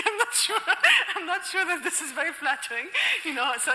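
laughing. Real, not acted. Laughing off a backhanded compliment.

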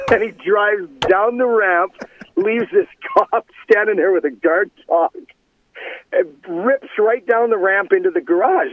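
laughing narration. Real, not acted. Laughing while retelling the story.

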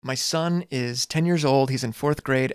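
ordinary talk

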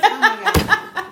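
big laughing exclamation, high and loud. Real, not acted. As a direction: burst out laughing, drawn out. Laughing at losing her train of thought.

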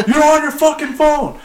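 high, indignant mock accusation; retold accusation. Boss accusing a worker over his phone, retold outrage.

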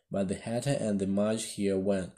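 ordinary delivery

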